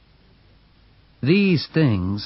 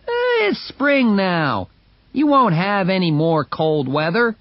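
voiced onlooker; 8 semitones higher than usual. A narrator's reading. Onlooker teasing Mercedes about the tent, easygoing. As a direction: shrug it off lightly. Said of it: "onlooker answers".

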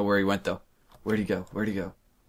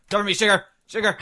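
higher, louder urgent call; cover me shout; 9 semitones higher, 4 dB louder than usual. Real, not acted. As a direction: yell for backup mid-fight. Calls teammate for cover in fight.

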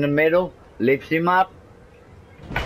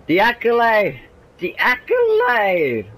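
excited; 8 semitones higher than usual. Real, not acted. Excited commentary on finisher.

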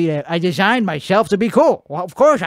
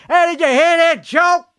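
high-pitched holler to the next room; raised, high teasing call; 10 semitones higher, 3 dB louder than usual. Real, not acted. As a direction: shout it over to your roommate. Calls out to roommate.

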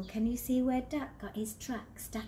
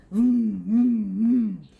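loud engine vroom; 14 dB louder, much slower than usual. Acted. Engine noise in story.

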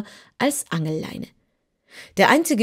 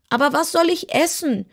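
much higher whiny kitten voice; whining kitten; 9 semitones higher than usual. Acted. Kitten wails to Dorothy about food. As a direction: whine it like a hungry cat. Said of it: "jammerte das Kätzchen".

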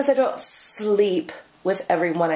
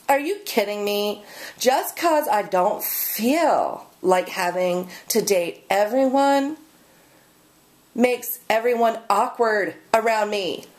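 ordinary talk, nothing marked

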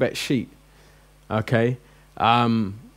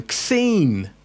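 mock street talk; 6 semitones higher than usual. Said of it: "you'll be going".

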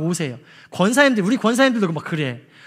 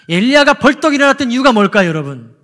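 higher and louder, full force; loud preaching question; 3 semitones higher, 7 dB louder than usual. Real, not acted. Preacher challenges the congregation, loud and higher. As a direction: call the question out to the church.

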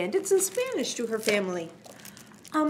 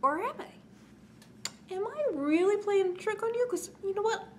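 playful teasing; 9 semitones higher than usual. Acted. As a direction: keep them guessing, sly and playful. April Fools tease to children.

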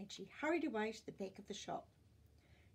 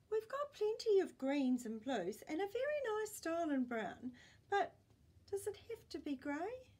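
higher, louder eager shop assistant; young salesman voice; 8 semitones higher, 5 dB louder than usual. Acted. Young salesman steering grandpa from grey, eager and higher. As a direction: push the other colours brightly.

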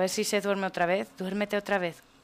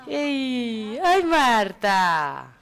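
long drawn-out, louder cry of delight; delighted greeting; 4 semitones higher, 6 dB louder, much slower than usual. Real, not acted. Player greets newborn Marta, delighted.

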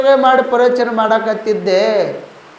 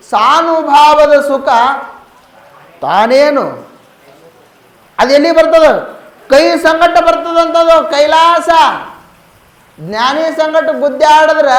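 raised sermon voice with drawn-out phrase ends; declamatory preaching; 3 semitones higher than usual. Acted. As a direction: proclaim the verse to a congregation.

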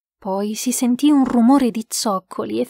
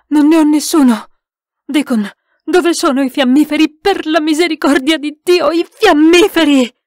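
loud, raised, frantic plea; high, urgent alarm; panicked shouting; 5 semitones higher, 7 dB louder than usual. A narrator's reading. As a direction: shout desperately over the chaos. Panicked exchange during attack.

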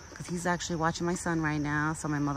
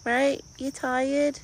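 pet talk; 9 semitones higher, much slower than usual. Real, not acted. Cooing at her deer.

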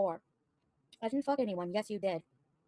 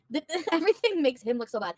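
ordinary talk

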